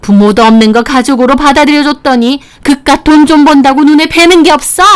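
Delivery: a hammed-up furious mother; enraged mother-in-law. (높은 톤으로 분노하는 장모님)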